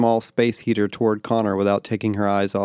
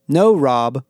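restrained narrated yell, a bit louder; 3 semitones higher, 5 dB louder than usual. A narrator's reading. Becky warding off the little robot, yelling. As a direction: scold the robot firmly. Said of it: "Becky yelled".

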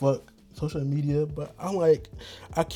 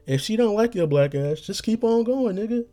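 mama's advice; 9 semitones higher than usual. Retold or imitated. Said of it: "My mama said the same thing".

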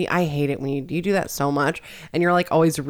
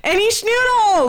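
very high, slow, squeaky "Any schnoodles"; mocking cutesy phrase; 4 dB louder, slower than usual. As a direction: tease him with a silly baby voice. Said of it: "what else you say that I hate".

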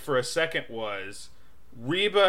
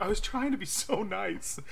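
inner thought; 6 semitones higher, 7 dB quieter than usual. Retold or imitated. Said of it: "I was like, in my head".

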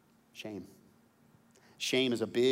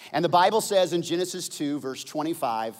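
much higher and louder, building sermon shouts; full-force preaching; 12 semitones higher, 11 dB louder than usual. Acted. Pastor drives home Adam and Eve's feeling, preaching loud. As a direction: preach it out to the back row.

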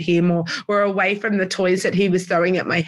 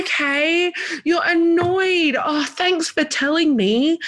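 much higher, slow, gentle sing-song; soothing mum; 9 semitones higher, slower than usual. Retold or imitated. Mum calmly acknowledging her toddler's annoyance, high and soothing. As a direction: coo it back to him, slowly.

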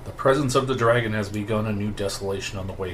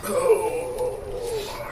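pained cry. Acted. NPC cries out, collapsing.

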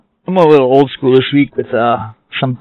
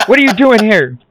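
higher, mock stern boss; hammed-up boss telling off worker; angry boss; 9 semitones higher than usual. Playing the boss scolding.

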